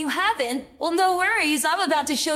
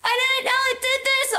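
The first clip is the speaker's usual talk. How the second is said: high creeped out; 10 semitones higher, 3 dB louder than usual. Real, not acted. Creepy filter.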